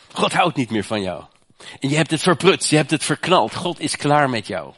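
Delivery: mock the inner accuser, pointing at the listener; voice of condemnation. Preacher voices the accusing voice of condemnation, scornful. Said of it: "Ken jij die stem?".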